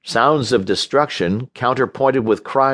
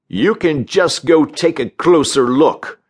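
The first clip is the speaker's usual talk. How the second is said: mildly snarled retort; touch of angry, higher-pitched snarl; 5 semitones higher, 3 dB louder than usual. A narrator's reading. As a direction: snarl back, pitched a little high. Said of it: "Barry snarled".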